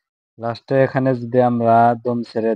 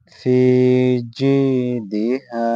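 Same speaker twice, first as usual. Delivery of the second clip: slow, drawn-out chanted recitation; slow tajweed recitation in Arabic; melodic Quran recitation; 3 dB louder, much slower than usual. Shown as a demonstration. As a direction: chant each syllable long and sung. Recites verse.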